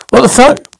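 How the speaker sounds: high startled what the fuck; jolted, voice jumping up; shocked outburst. Acted. Sudden gun drawn.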